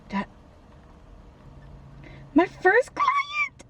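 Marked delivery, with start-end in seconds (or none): 2.3-3.6 high, slow squeal of joy; slow high shriek of joy; excited squeal. Real, not acted. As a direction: squeal with delight. Excited about first client.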